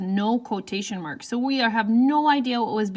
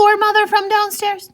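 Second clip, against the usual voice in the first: 1 mock yell; 10 semitones higher, 7 dB louder than usual. Shown as a demonstration. Wrong take, all called out.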